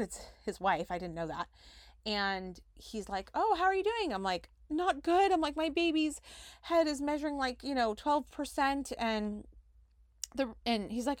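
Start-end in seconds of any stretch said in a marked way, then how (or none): 3.3-4.1 high, bright mock-friendly hello; imitating a chipper doctor; cheery doctor greeting. Doctor greets her in hallway. 4.7-8.9 higher, strained and worried; imitating her frightened self; retold distress. Her upset reply to doctor.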